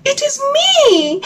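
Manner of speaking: very high little frog voice; high middle frog. Acted. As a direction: chirp it like a small frog.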